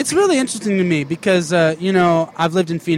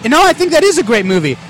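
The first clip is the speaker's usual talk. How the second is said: usual speaking voice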